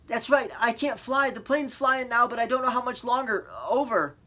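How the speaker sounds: high, loud frightened voice; scared reply. A narrator's reading. Boy answering the operator, high and frightened. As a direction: push the words out in fear.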